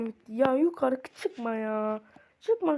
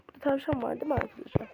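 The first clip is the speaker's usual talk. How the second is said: ordinary talk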